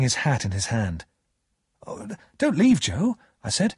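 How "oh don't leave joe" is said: high, urgent plea; pleading. A narrator's reading. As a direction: beg a friend not to go, voice lifted high. Pip pleads with Joe to stay.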